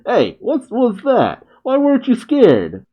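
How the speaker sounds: high-pitched miffed character voice; a little pissed. Retold or imitated. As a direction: whine up high in mock indignation. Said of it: "Ten Ten's a little pissed".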